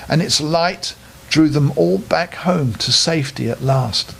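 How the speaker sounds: ordinary delivery, nothing marked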